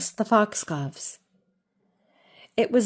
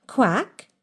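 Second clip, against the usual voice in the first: short rising quack; a curious little quack; duck quack; 3 semitones higher, 3 dB louder than usual. Acted. Jemima startled by the gentleman, questioning quack.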